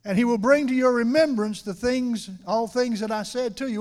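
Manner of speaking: loud, ringing preacher's reading of scripture; scripture proclaimed. Acted. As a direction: proclaim the verse to the whole church. Reads scripture at full force.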